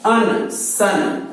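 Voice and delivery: अन then सन drawn out very slowly; slow blending. Shown as a demonstration. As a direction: glide from अन into सन slowly. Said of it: "teacher blends SUN". (धीरे-धीरे सन बोलना)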